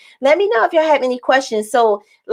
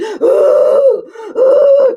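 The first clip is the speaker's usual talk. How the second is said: loud, high-pitched crying noise; mock wailing sob; 14 semitones higher, 6 dB louder than usual. Mother crying when baby is born, imitated wail. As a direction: imitate a bawling "hoo". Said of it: "I'm crying, like".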